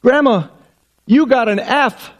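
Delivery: put-on high, triumphant child's voice; little girl. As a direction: announce it like a kid who caught grandma out. Girl reads license.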